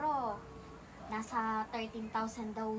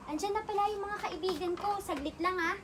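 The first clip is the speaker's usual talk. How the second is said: higher, livelier, louder aside; excited, pitched-up interruption; hurried excitement; 8 semitones higher, 4 dB louder than usual. Acted. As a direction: announce the arrival brightly and ask for a moment. Friends arrive, breaks off to them.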